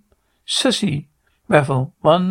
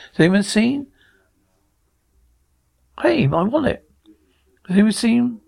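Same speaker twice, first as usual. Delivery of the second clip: higher-pitched, questioning call; anxious searching; 6 semitones higher than usual. Acted. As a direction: ask around for a lost wallet, voice raised in pitch. Asking around for a lost wallet.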